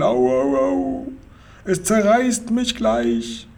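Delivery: high wailing moans of pain; howling.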